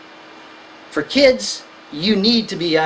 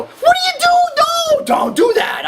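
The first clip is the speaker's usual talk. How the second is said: much higher and louder, a shocked shout; alarmed parent; 5 dB louder than usual. Retold or imitated. Lecturer acting a parent shouting at a son knocking icicles, high and loud. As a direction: yell at the kid in alarm. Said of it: "being a concerned parent, go".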